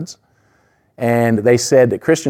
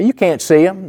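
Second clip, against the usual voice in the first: higher, taunting jailer voice; mocking jailer; 9 semitones higher than usual. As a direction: sneer it like a jailer.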